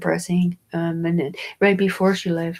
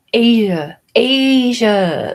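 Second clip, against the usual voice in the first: louder, higher mock-outraged Asia; exasperated exclaim; 4 semitones higher, 9 dB louder, much slower than usual. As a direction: belt it out in disbelief.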